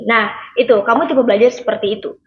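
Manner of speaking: normal talking voice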